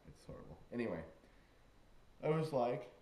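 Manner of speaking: ordinary talk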